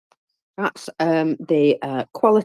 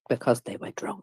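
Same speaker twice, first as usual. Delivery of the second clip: whisper; 8 semitones lower, 11 dB quieter than usual. Real, not acted. Punchline dropped to a whisper.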